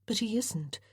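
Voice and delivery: soft murmured aside. Acted. Fenella confiding to Chatton, softly murmured. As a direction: drop the voice to a murmur. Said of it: "Fenella murmured".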